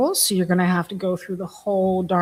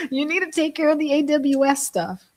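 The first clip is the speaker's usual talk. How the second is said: raised pitch, laughing through the ask; laughing request; 8 semitones higher than usual. Retold or imitated. To her brother on the phone, laughing, higher-pitched request. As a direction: laugh while telling your brother to handle it. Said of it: "I said".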